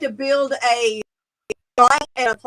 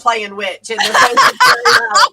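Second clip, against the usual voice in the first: high, loud laughing quote; 6 semitones higher, 10 dB louder than usual. Retold or imitated. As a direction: laugh through the line. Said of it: "I said".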